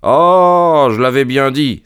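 high, loud, drawn-out "Ah"; triumphant cry. A narrator's reading. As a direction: exclaim it, pitched well up. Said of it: "s'écria-t-il". (exclamation triomphante)

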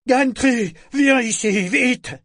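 high, loud frantic call; panicked cry. Acted. Torg fleeing, calling the mate in panic. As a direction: scream for help in terror. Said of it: "cria-t-il éperdu".

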